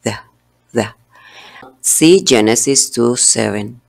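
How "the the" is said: The said almost like a d, tongue barely touching; soft d-like the. Shown as a demonstration. Teacher showing the d-like th in the, soft demo. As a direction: say The close to a D sound. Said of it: "Suena casi como una D".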